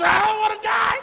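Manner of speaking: high loud panicked whine with a laugh; mock panic. Patient afraid of dying, pleading with the nurse in a high loud whine. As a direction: cry out in hammed-up fear.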